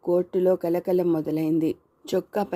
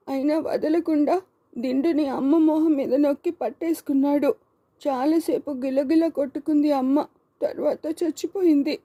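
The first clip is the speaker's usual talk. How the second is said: put-on high, distressed little boy's voice; tearful child; 9 semitones higher than usual. A narrator's reading. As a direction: recount it as a boy on the verge of sobbing. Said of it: "వెక్కిళ్ళు పెడుతూ ఏడవడం మొదలెట్టాడు".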